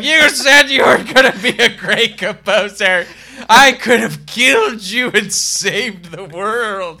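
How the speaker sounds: loud, high, slow, laughing rant; ghost father. Acted. Ghost father scolding Hans, loud laughing rant. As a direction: rant like a vengeful ghost dad. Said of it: "He's like".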